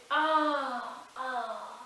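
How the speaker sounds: short pained cries of ah; two short pained cries; pained cry. A narrator's reading. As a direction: cry out as if struck by an arrow. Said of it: "and he shouted".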